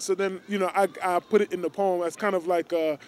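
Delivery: usual speaking voice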